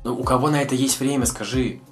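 ordinary delivery

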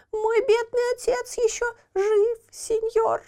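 put-on high lamenting child voice; pitiful puppet boy. A narrator's reading. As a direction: plead in a high thin voice. Buratino voiced in story.

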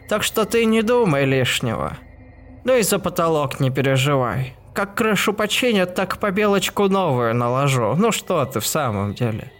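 put-on cackly old woman, kindly chiding; crackly old woman voice. A narrator's reading. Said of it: "трескучим старческим голосом".

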